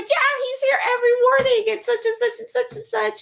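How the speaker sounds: very high-pitched barista imitation; gushing chatter. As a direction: rattle on like an enthusiastic fan.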